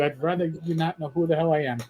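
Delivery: ordinary talk, nothing marked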